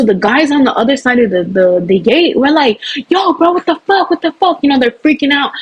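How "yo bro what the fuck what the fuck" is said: much higher, panicked; mock panicked bystander yelling; freaked-out bystanders. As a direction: imitate guys freaking out.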